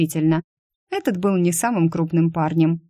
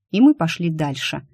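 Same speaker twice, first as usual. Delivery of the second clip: plain reading voice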